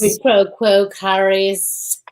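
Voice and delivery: a hint of a creepy Lecter voice; lightly voiced creepy doctor; a touch of movie villain; Hannibal Lecter. Lecter impression.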